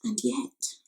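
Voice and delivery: ordinary talk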